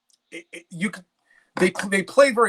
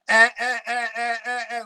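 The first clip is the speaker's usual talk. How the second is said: high clipped eh sounds, lightly imitating a singer; imitating a vocal crackle; 6 semitones higher than usual. Host imitates singer [name]'s crackle to his co-host, a light high mimic. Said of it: "if he was just going".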